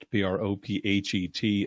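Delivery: P R O P H E T spelled one letter at a time. Shown as a demonstration. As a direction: say each letter of P R O P H E T separately. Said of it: "called The Prophet".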